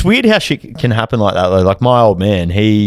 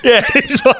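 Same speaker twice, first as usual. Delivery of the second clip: laughing; slower than usual. Real, not acted. Laughing while retelling.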